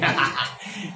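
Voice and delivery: short amused laugh. Real, not acted. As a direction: burst of laughing at a silly mistake. Laughs at the wrong recitation.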